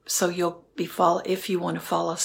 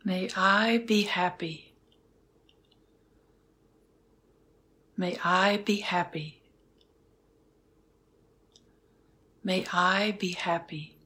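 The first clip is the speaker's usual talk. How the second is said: guided meditation. Acted. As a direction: guide them gently into stillness. Leads a metta meditation.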